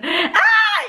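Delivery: high mock scream; imitated excited scream. Driving instructor waiting outside reacts to the student, imitated excited scream. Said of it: "så var han bare sådan".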